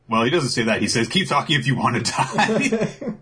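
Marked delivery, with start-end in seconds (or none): 1.1-3.2 high, hammed-up angry threat; mock threat. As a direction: threaten while breaking into laughter. Voices Bakugo's threat, amused.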